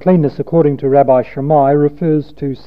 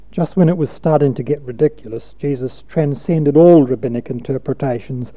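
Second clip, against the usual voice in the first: ordinary talk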